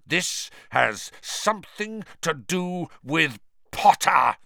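high, furious insistence; roaring anger. A narrator's reading. Professor blaming a boy for the escape, furious. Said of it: "Snape roared".

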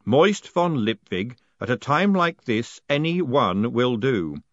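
slow, loud, booming spirit voice; ghostly voice. Acted. The haunted post office pronounces Moist postmaster, slow and booming. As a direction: intone it like the building itself speaks. Spectral voice continues.

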